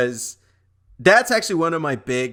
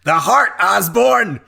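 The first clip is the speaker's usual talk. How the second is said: mock movie line; 7 semitones higher, 5 dB louder, slower than usual.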